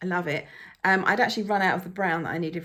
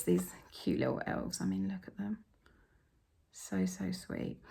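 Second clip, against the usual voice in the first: softer, hushed delight; soft cooing; 8 dB quieter than usual. Real, not acted. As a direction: murmur it fondly, slowing on so sweet. Cooing over the stitched elves.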